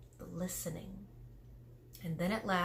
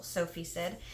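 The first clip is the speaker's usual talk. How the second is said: usual speaking voice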